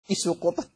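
كيس قطن said as kisu qutn; long i in كيس, qaf and u in قطن; misreading. Retold or imitated. Teacher showing students a copyist's error, misread kisu qutn in كيس قطن. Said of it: "هذا من التصحيف".

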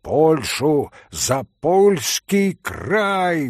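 high, slow rousing toast; drunken exclamation to the Polish gentlemen; exclaimed toast. Acted. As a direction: raise the glass and exclaim it. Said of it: "воскликнул Митя".

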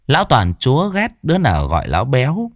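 plain reading voice

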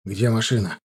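ordinary delivery

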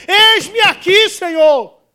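loud, slow, high cry of surrender; fervent cry. Acted. Preacher voices the believer answering God, loud and slow. Quoting Isaiah at the climax.

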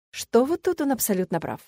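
plain reading voice

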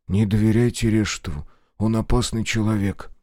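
ordinary delivery

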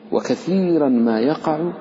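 ordinary talk

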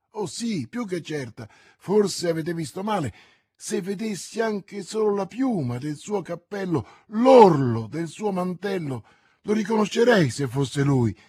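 high, loud, impassioned insistence; insist, swelling on each item; emphatic certainty. Acted. Bonacieux insists she would recognize him.

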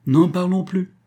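ordinary delivery, nothing marked

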